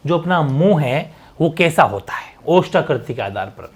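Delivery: ordinary delivery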